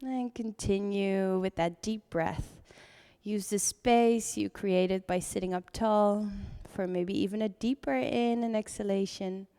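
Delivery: calm voice easing the class into breath; guided meditation. Acted. Leads seated breathing meditation.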